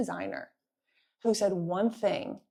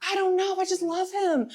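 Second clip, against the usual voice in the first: pitched way up, swooning and helpless; high dreamy lovestruck; 10 semitones higher, 3 dB louder than usual. Retold or imitated. Friend defending the convict boyfriend, high swooning mock voice. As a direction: sigh it out like she's smitten. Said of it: "She's like".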